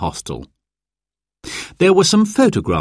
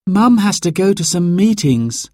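put-on high young girl explaining; a little girl telling Dad; child voice; 10 semitones higher than usual. A narrator's reading. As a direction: daughter explains to her father. Narrator voices child Sarah.

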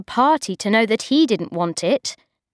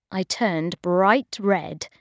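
plain reading voice

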